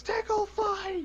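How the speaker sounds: put-on squealing high child's battle cry; excited little girl. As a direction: shriek it like a playful kid. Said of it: "Samantha charges Finn and says".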